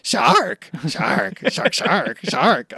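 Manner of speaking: lightly voiced creature chanting its name; a hint of a Pokemon cry, shark shark. Co-host imitating the shark who talks like a Pokemon, lightly voiced. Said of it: "he talks like goddamn Gombe".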